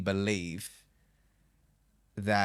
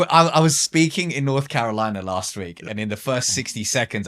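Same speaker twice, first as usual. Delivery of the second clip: usual speaking voice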